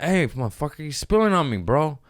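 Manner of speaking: feisty yell. Retold or imitated. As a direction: call him out like a feisty guy on the plane. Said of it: "I'm fairly feisty".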